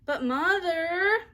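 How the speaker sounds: high, loud and slow drawn-out plea; insistent child plea. A narrator's reading. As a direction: drag it out like a desperate little girl. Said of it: "insisted Ramona even more urgently".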